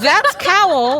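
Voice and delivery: high, drawn-out exclamation; emphatic reveal. Real, not acted. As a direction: announce it high and slow. Correcting amid comic confusion.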